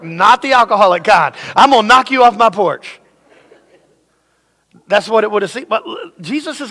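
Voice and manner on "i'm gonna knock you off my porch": mock threat. Threatening neighbor at the door.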